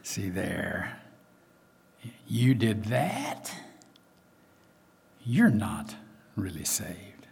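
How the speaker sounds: low, soft devil voice, trailing to a whisper; accusing devil. Acted. Devil accusing a believer who tripped, soft, low taunting. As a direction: taunt softly and slyly as the devil, with long pauses. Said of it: "And the devil comes and says".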